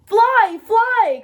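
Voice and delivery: loud, high call to flee; urgent call. A narrator's reading. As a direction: shout the alarm across the trees. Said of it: "called the birds".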